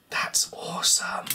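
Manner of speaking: deadpan breathy whisper, slow; sarcastic whisper. Real, not acted. Annoyed repairer under his breath, slow sarcastic whisper. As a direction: whisper the praise so it means the opposite. Sarcasm after breaking things.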